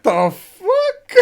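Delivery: high, loud, laughing disbelief; retold burst of shocked disbelief; incredulous. Gamer retelling his shock at a strange wedding vow, incredulous.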